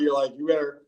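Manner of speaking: ordinary talk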